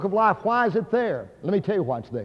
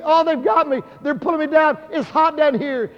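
screaming retelling; 9 semitones higher than usual. Retold or imitated. Dying brother screaming from his hospital bed, retold as high panicked cries. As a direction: scream it high, imitating a terrified dying man. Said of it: "because he was screaming".

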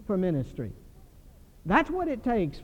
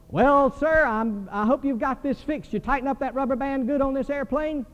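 teasing passenger; 6 semitones higher than usual. Retold or imitated. Joking passenger teases pilot.

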